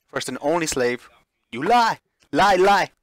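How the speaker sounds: high, loud raging denial; furious scream. Acted. Ashley screaming at Lillian, furious and loud. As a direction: scream the accusation in fury. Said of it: "She screams furiously".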